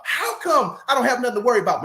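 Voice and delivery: high, quick panicky wife voice; frightened wife. Retold or imitated. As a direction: rush it out like a wife sure they'll die. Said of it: "And she said".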